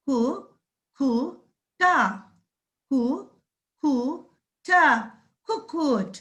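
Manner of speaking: कु, कु, टा separated then joined as कुक्कुट; syllable by syllable. Shown as a demonstration. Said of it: "कैसे बोलेंगे बच्चों".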